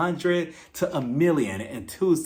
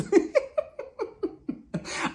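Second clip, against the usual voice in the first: long high breathy cackle; gleeful long laugh. Real, not acted. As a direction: howl at the comeback. Laughing at New York's comeback.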